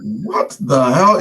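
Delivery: re-enacted stunned outburst; astonished. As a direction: stretch the words in shock. Astonished that it was really him.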